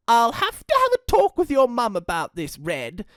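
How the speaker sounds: put-on apologetic old gran; fussy granny voice. Gran voice held.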